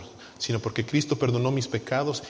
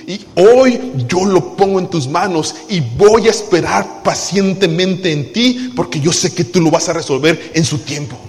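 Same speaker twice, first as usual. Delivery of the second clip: raised, driving preacher voice; preaching at full force; 3 semitones higher, 7 dB louder than usual. Acted. Preaching a model prayer.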